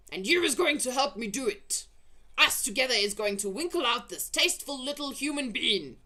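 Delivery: loud, high bullying shout; giant shout. Acted. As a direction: order him about. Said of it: "he shouted".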